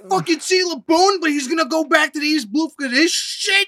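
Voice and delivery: high, loud retold rant; mock outraged outburst.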